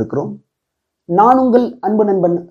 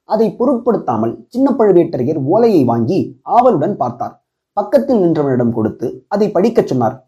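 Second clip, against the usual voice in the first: plain reading voice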